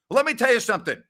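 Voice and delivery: normal talking voice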